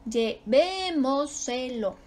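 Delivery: Llevémoselo slowly, with a long VÉ; lle-VÉÉ-mo-se-lo, slow and segmented; stressed VÉ. Shown as a demonstration. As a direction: stretch VÉ in Llevémoselo. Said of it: "Ahí está su sílaba tónica".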